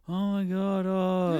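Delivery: put-on high, startled girls' voice; imitating the girls' shock; shocked gasp. Girls shocked by flooding news.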